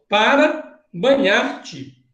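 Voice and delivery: "banhar-te" read out slowly; slow and deliberate. Shown as a demonstration. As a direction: draw out "para banhar-te" for the class. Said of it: "Ó". ("para banhar-te" dito bem devagar)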